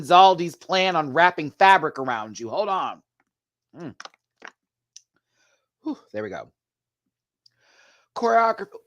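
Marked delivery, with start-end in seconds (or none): none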